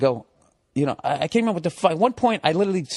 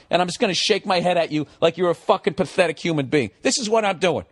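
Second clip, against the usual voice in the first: ordinary talk, nothing marked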